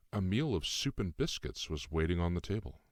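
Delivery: ordinary delivery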